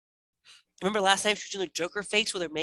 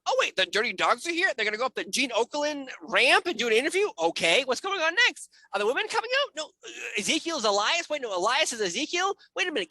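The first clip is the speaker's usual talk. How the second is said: rising, higher and higher disbelief, ending in a yell; exasperated rant; 8 semitones higher than usual. Real, not acted. Venting about a confusing wrestling show, exasperated rant. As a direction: pile on the confusion until it bursts.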